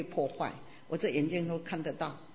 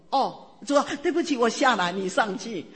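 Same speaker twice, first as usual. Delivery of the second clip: eager apology to God; 3 semitones higher, 7 dB louder than usual. Retold or imitated. Retelling her answer to God.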